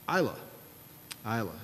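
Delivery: "Islay" said as EYE-luh, silent s; pronunciation demo. Shown as a demonstration. Teaching the congregation his professor's pronunciation, "Islay" as EYE-luh. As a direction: carefully show "Islay" as EYE-la. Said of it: "taught me how to pronounce".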